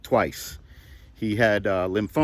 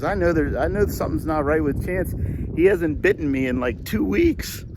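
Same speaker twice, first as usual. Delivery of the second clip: higher, animated mock concern; joking; 6 semitones higher than usual. Owner joking about his dog not biting him, animated. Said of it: "the other stupidly funny thing I said".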